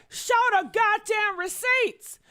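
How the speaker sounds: loud, high demand for proof; demanding shout. Retold or imitated. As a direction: bark it out like an order. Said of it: "And that's why I said".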